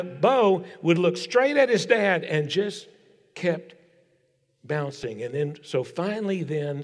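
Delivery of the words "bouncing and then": ordinary talk, nothing marked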